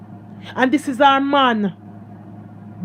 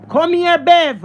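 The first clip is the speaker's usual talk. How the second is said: louder, called across the yard; calling out; 3 semitones higher, 4 dB louder, slower than usual. Retold or imitated. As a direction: call her over from a distance. Grandmother calling out to mother.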